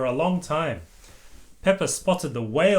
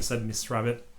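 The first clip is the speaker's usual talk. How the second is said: plain reading voice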